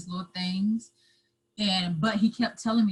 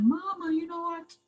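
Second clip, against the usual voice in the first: crying plea; 11 semitones higher than usual. Retold or imitated. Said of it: "I just broke down crying".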